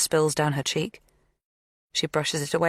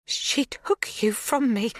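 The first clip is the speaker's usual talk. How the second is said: anguished accusation; 8 semitones higher, slower than usual. A narrator's reading. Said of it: "Her voice is louder".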